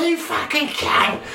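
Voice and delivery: very high, slow yobbish shout; mock British yob. British tourist fighting at the plane door, mock yell. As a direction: bellow it like a pushy lout.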